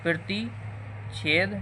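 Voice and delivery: प्रतिच्छेद said very slowly, syllable by syllable; slow spelled term. Shown as a demonstration. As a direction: draw out प्रतिच्छेद for the class. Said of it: "इसी को बोलेंगे प्रतिच्छेद बिंदु".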